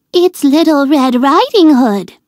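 wolf pretending to be the girl, sweetly. A narrator's reading. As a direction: put on a sugary disguise voice. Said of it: "he said in a sweet voice".

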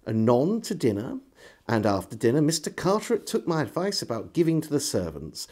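ordinary delivery, nothing marked

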